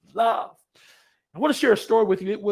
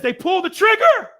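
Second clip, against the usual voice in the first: high, loud, drawn-out cry; storyteller's peak at full force; shouted story climax; 11 semitones higher, 13 dB louder than usual. Retold or imitated.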